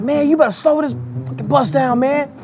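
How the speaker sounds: lightly imitated angry warning, pitched high. Juvenile warning the bus driver, mock angry. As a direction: tell the driver off, half-hearted imitation. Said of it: "Juvenile was like".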